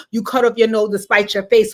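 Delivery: ordinary talk, nothing marked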